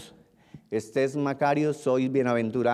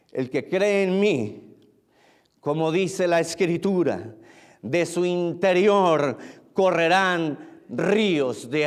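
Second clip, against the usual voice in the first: slow, drawn-out preaching with high peaks; emphatic preaching; 4 semitones higher, 3 dB louder, slower than usual. Real, not acted. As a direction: proclaim each phrase weightily, with pauses. Preaches the verse with force.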